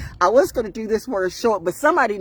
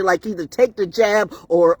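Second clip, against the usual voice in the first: ordinary talk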